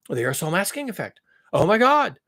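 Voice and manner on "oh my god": loud, higher mock dismay; sarcastic alarm. Mocking worry over lost aerosols, sarcastic alarm. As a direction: exclaim in pretend panic. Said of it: "I'm being sarcastic here".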